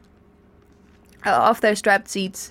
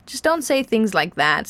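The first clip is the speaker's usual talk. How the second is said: ordinary delivery, nothing marked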